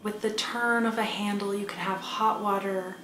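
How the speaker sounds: soft, unhurried meditation voice with long pauses; calm, hushed guidance over quiet music; guided meditation. Acted. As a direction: gently guide a listener inward, leaving space after each phrase.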